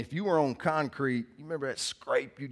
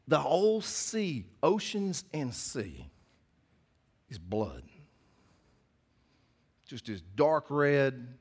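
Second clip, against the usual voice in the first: slow vivid imagery; 2 semitones higher, slower than usual. Real, not acted. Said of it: "this is what I need you to envision".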